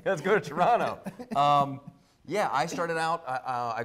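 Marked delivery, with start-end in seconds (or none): 0.1-1.0 put-on playful Canadian accent, higher and louder with laughter; mock Canadian accent. Guest joking back in a put-on Canadian accent. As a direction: ham up Canadian, eh. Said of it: "Canadian accent".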